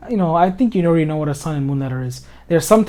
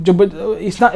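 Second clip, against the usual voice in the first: ordinary delivery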